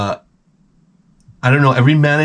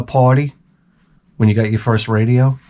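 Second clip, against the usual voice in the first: ordinary delivery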